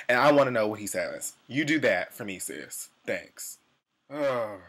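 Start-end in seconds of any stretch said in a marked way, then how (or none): none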